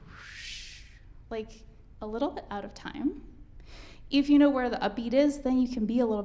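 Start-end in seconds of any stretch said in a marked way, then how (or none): 0.1-0.8 a soft, voiceless whoosh; breathy whoosh. Shown as a demonstration. Teacher whooshes to show notes floating out of time. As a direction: let the sound sweep past like air. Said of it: "It can also be like".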